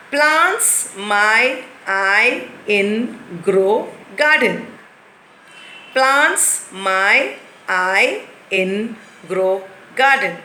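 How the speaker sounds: very slow, word-by-word reading; slow jumbled reading. Shown as a demonstration. Teacher slowly reads the jumbled words twice. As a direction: read out the muddle carefully. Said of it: "reading jumbled words twice".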